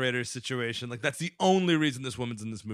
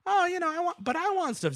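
put-on high-pitched child's protest; squeaky little-boy whine; whiny kid. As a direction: mimic a kid pleading his case. Kid whining back about praying.

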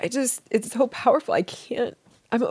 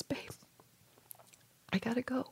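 a soft whisper, much softer than her talk; whispered farewell; 13 dB quieter than usual. Retold or imitated. Husband telling her in the dream he must leave, whispered. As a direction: breathe the goodbye gently.

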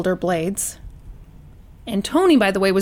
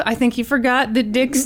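plain reading voice

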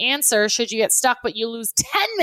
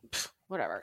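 exasperated, giving up with a pff; dismissive pfft; 13 semitones lower, 14 dB quieter, faster than usual. Real, not acted. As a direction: brush it off with a scoff. Gives up on screen share.

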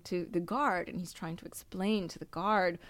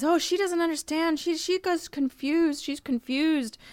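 high, lightly voiced guide talking his way out; a higher mock voice insisting she's confused; imitating the guide's excuses; protesting tour guide; 9 semitones higher, slower than usual. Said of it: "And he says".

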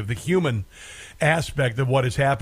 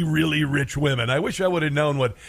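usual speaking voice

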